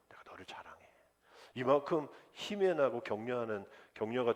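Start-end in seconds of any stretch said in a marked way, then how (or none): 0.1-0.9 dropped to a whisper on the last line; hushed confession. Acted. Pastor whispering love to his family. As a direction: breathe it like a secret to family.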